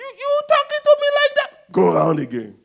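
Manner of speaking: God scolding his grumbling people; mock-indignant God. God voiced scolding Israelites.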